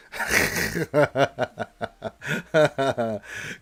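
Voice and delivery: long, breaking-out laugh; real laughing fit; amused laughter. Real, not acted. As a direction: crack up for a few seconds. Laughs at the question.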